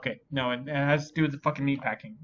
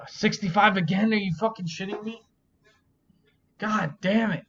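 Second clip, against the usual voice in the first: higher and louder, venting at a bad score; angry and incredulous, pitched up; exasperated outburst; 7 semitones higher, 5 dB louder than usual. Real, not acted. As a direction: blurt it out in furious disbelief. Bad quiz score, disbelief.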